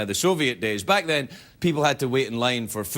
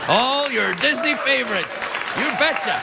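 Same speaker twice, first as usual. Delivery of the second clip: lightly hammed-up Alaskan hockey mom; mock Sarah Palin; 7 semitones higher than usual. Host jokes Palin sees Disney from her house, mock folksy.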